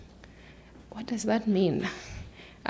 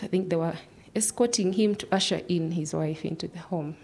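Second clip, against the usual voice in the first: normal talking voice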